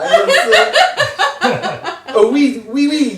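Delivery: high, loud, laughing fake French accent; mock Russian-French accent. Player mangling a French-Russian accent, laughing high and loud. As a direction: ham up a Frenchman trying to sound Russian. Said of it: "do it with a French accent".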